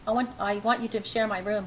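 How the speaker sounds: ordinary delivery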